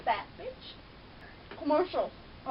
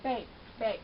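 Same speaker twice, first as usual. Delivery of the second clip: normal talking voice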